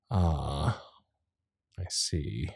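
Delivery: very soft, low murmur; awkward realisation. Acted. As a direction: take it in quietly, almost under the breath. Absorbing an awkward revelation.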